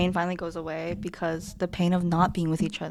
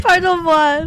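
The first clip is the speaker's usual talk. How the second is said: gasped French; 8 semitones higher, 9 dB louder, slower than usual. Acted. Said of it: "the monster gasped out".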